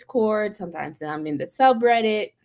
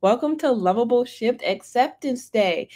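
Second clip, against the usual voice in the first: normal talking voice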